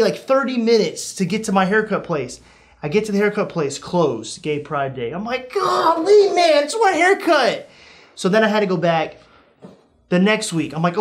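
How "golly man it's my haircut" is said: high-pitched mock protest; exasperated whine. Customer finding the haircut place closed, exasperated whine. Acted complaint at closed shop.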